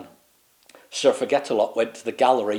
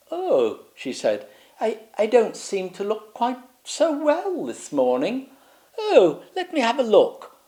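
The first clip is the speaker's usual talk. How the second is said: high, fretful royal voice; a vain queen worried by her looks; fussy queen; 10 semitones higher than usual. Acted. Queen fretting at the mirror, high and fussy.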